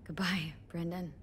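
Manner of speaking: hushed, sad farewell. Real, not acted. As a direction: barely whisper the goodbye. Farewell to the dying machine.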